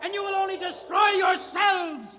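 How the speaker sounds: high ringing oration; impassioned proclamation. Acted. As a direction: proclaim the vision to the crowd. Hoyland's visionary speech under roof.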